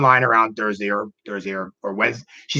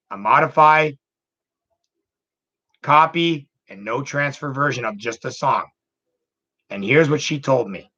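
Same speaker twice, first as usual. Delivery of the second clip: ordinary talk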